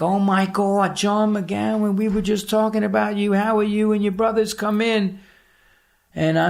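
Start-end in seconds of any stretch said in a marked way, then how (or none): 0.0-5.2 higher, fast, a hint of a fake-cheerful hostess; lightly voiced sugary welcome; mock gushing welcome. Daughter greets returning foster kid, mock gushing. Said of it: "she's like".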